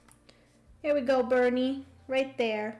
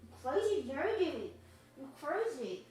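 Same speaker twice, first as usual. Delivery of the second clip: higher, softer teasing pet voice; doggy talk; 7 semitones higher, 7 dB quieter than usual. Real, not acted. Owner calling Penny a crazy lady in baby talk. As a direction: tease the dog lovingly.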